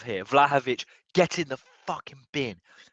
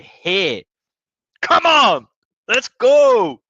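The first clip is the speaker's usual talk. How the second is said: loud, much higher roar; triumphant shout; 12 semitones higher, 11 dB louder, much slower than usual. Real, not acted. Fan cheering his team's win, triumphant shouting. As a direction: belt it out like a terrace chant. Celebrating goal.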